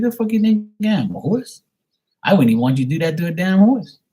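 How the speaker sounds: outraged high retort. Retold or imitated. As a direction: squeal it in disbelief. Retelling dentist outrage.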